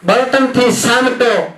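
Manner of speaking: high, slow, ringing recital; lofty verse climax; soaring poem line. Acted. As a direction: lift the line up to the crowd.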